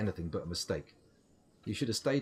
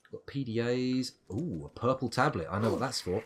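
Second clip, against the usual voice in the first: ordinary talk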